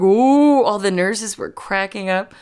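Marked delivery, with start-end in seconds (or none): 0.0-0.6 loud ghostly moan; Jacob Marley moan. Retold or imitated. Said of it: "did Jacob Marley in the wheelchair".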